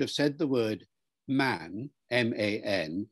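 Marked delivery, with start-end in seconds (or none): none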